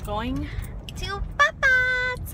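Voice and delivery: going to see grandpa, thrilled; excited squeal. Real, not acted. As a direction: sing it out with delight. Excited about going to Papa's.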